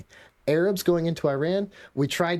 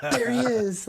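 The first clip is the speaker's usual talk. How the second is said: high laughing line; 10 semitones higher, slower than usual. Real, not acted. Picturing John Belushi in mountains, laughing.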